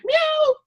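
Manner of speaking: very high, thin imitated meow. The stray cat out in the thunderstorm, a very high thin meow. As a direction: mew like a small cat out in the storm.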